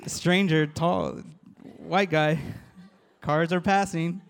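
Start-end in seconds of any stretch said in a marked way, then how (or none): none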